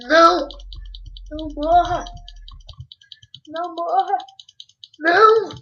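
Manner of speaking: loud, high panicked no; desperate shout. Real, not acted. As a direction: scream it as if losing something. Pet or mob dying in-game.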